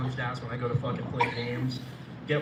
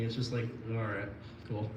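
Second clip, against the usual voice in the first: normal talking voice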